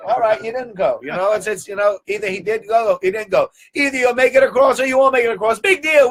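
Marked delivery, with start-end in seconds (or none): none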